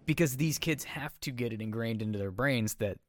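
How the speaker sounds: ordinary delivery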